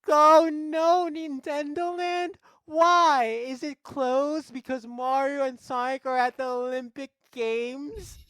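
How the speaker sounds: very high, loud melodramatic princess; hammed-up falsetto distress; Peach. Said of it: "And Peach is like".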